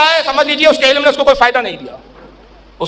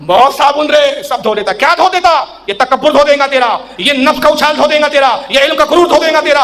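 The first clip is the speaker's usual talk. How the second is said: rising preaching; 5 semitones higher than usual. Real, not acted. Preacher building to a fervent climax about washing away pride. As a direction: drive the point home harder each line. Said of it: "preaching builds to full force".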